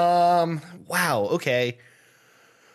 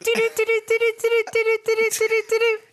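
high squeaky giggle; 5 dB louder than usual. Real, not acted. Long squeaky laugh.

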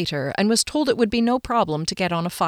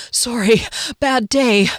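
panting between words, louder; out of breath; 4 semitones higher, 4 dB louder, slower than usual. Acted. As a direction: gasp it out after running. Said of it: "I said between huffs of breath".

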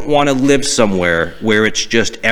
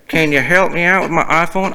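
put-on deep Southern accent; 6 semitones higher than usual. Tennessee caller needing iPhone help, deep Southern accent. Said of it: "had a real deep Southern accent".

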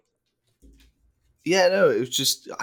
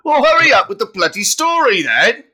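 loud exasperated demand; impatient shout; 11 semitones higher, 8 dB louder than usual. Real, not acted. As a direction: yell at him to get on with it. Impatient outburst.

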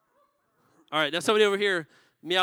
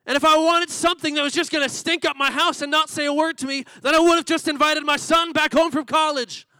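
loud, high, furious tirade at the shop owner; angry shout; 7 semitones higher, 7 dB louder than usual. Acted. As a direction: blast him with every word. Furious customer confronts pet store owner.

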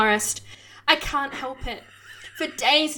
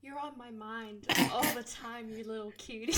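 hushed, intimate lamia voice; soft and quiet; 2 semitones lower, 13 dB quieter than usual. Acted. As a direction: murmur it close and soft. Lamia whispers affection.

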